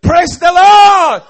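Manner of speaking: slow, huge shout of praise; drawn-out rallying cry. Real, not acted. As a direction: bellow it out to the whole church. Preacher rallies the congregation.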